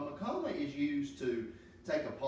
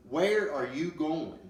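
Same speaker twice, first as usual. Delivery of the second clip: slow, louder, upset demand; agitated; 3 semitones higher, 5 dB louder, slower than usual. Shown as a demonstration. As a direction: act out a fed-up passenger's agitation. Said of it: "that type of agitation in our voice".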